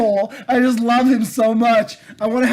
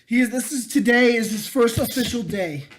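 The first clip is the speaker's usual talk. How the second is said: usual speaking voice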